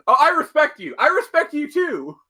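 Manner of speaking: high, loud mock battle shouting; shouted mutual respect. Wonder Woman fighting Thor, both shouting respect mid-fight. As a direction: yell it as two fighters trading blows. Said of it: "just being like".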